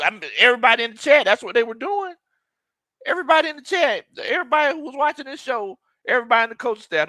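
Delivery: high, fast and animated; hyped insistence, pitch way up; worked-up excitement. Real, not acted. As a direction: rush it out, voice climbing. Hyped recalling everyone's high expectations.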